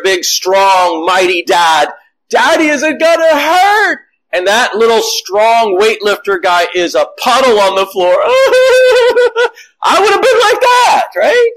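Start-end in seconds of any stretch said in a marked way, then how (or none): 2.3-4.0 put-on lifted high like a worried little boy; small child's question. Child voiced asking his dad. 8.2-9.5 a burst of high laughter at himself. Real, not acted. 9.8-11.5 said high through laughter; laughing. Real, not acted. Self-mocking after laugh.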